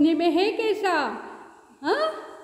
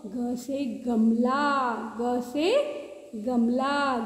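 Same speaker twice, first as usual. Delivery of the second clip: ग से गमला drawn out very slowly, lower; teacher drawing out ग से गमला for toddlers; slow recital; 5 semitones lower, much slower than usual. Shown as a demonstration. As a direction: stretch every syllable of ग से गमला. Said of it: "slow recital for kids".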